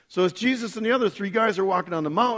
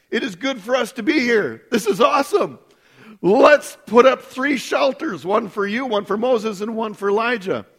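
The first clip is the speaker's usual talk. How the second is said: excited Peter; 5 semitones higher, 5 dB louder than usual. Retold or imitated. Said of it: "He's like".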